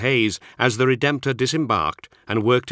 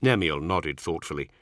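usual speaking voice

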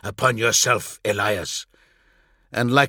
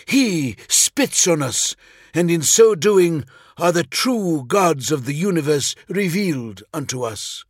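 put-on a higher, fervent apostle denouncing his old god; zealous preacher voice; 7 semitones higher, 4 dB louder than usual. Elias zealously condemns his god.

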